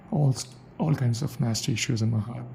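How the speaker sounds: ordinary talk, nothing marked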